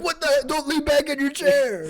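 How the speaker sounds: high, loud, slow fake outrage; mock scolding. Classmates mock-scold a kid they toppled, high and loud. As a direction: ham up the innocent scold. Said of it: "be like".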